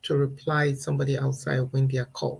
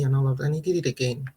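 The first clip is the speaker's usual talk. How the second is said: ordinary talk, nothing marked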